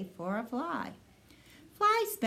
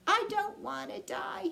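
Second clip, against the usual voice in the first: moaning; 12 semitones higher than usual. A narrator's reading. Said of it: "he moaned".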